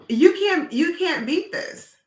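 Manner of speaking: high, excited insistence; you can't beat this, high and eager; a delighted, high-pitched "you can't beat this". Real, not acted. Enthusing about technology.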